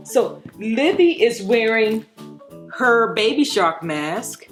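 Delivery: usual speaking voice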